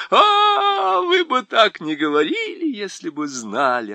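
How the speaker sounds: high, loud sobbing cry; sobbing wail. Acted. Faun sobbing to Lucy that she would not say so. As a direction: sob it out in despair. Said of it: "всхлипывая".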